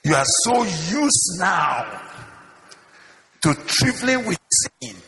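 high, forceful preaching; impassioned warning. Real, not acted. Preacher warning those used to trifling with sin, impassioned and high. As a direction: drive the warning home from the pulpit.

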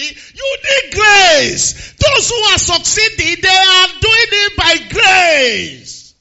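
slow, loud preacher shout; preaching. Acted. Preacher shouting slowly to the church. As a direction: drive it home to the congregation.

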